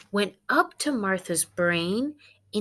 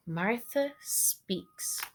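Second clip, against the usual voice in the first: soft unvoiced whisper of the title; 9 dB quieter, much slower than usual. Teacher to class, whispering the title. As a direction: hush it like a secret.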